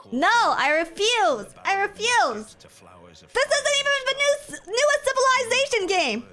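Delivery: high, loud outraged ranting; shrieking rant. Real, not acted. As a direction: screech at the game in outrage. Ranting at the game.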